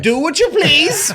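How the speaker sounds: very high, loud, slow laughing line; squealed mock dismissal; hammed-up laughing voice. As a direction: ham it up through a laugh.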